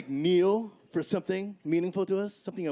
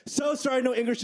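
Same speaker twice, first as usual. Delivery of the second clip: fake broken English; 6 semitones higher than usual. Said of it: "Gotta love".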